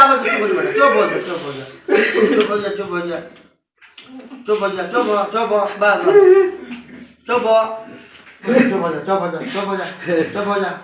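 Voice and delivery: soft, low murmured hushing; calm the sobbing child down gently; lulling a child to quiet; soothing hush. Acted. Soothing crying boy.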